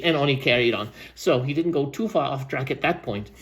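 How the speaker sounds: ordinary delivery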